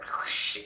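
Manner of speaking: unvoiced scraping whoosh noise; harsh whispered swoosh; imitated record scratch. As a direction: mimic a scratchy scrape with your mouth. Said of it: "it sounded like the record scratch".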